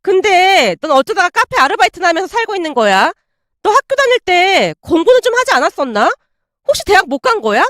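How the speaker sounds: put-on condescending mean-girl voice; mocking, amused. A narrator's reading. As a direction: needle them with fake curiosity. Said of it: "웃으며 물었어요".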